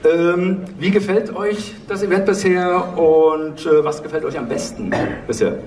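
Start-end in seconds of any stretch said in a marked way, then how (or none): none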